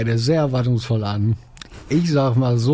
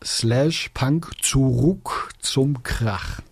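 slow, word-by-word readout; unhurried, each part set apart; slow dictation; much slower than usual. Real, not acted. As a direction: dictate the address piece by piece. Dictates web address to listeners.

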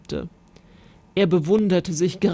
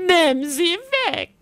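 terrified scream; 9 semitones higher than usual. Acted. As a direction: shriek at the spider. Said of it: "schrie sie".

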